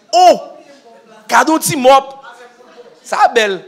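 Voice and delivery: driver's loud, high, delighted cry; excited exclamation. Retold or imitated. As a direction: exclaim the gift with glee. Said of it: "il dit".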